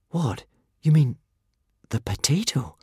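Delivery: high, hushed astonishment; gasped. A narrator's reading. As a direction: gasp it, fading into a whisper. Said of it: "gasped Hiccup".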